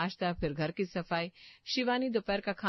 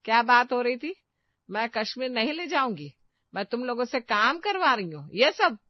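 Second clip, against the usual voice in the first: loud, higher stern mother-in-law; stern scolding; 5 semitones higher, 7 dB louder than usual. Acted. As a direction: confront them sharply. Mother-in-law confronting daughters-in-law. (कड़क आवाज़ में डांटना)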